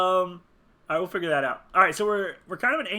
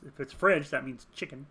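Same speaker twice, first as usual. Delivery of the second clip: ordinary talk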